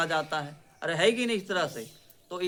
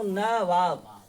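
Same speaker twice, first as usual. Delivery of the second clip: Nawab stretched out very slowly; very slow demo; 4 dB louder, much slower than usual. Shown as a demonstration. Teacher to class, very slowly dictating the word. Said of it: "नवाब ऐसे लिखाता है".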